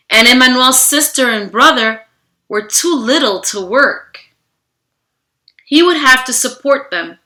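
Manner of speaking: steady storybook narration, slowing on key moments; reading to children. Acted. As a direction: read the story aloud to a class. Reads picture book to children.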